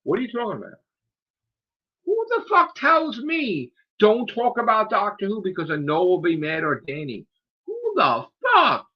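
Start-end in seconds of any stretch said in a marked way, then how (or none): 2.1-8.9 outraged. Real, not acted. Outraged rant.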